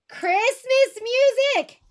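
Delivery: "Christmas music!" jumps way up high and loud; shocked outburst. Retold or imitated. Telling the viewers about a store trip, shocked exclamation. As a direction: burst out in mock outrage. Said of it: "I was shocked".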